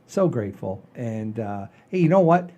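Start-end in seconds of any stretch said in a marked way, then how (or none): none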